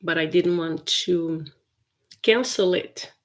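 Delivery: usual speaking voice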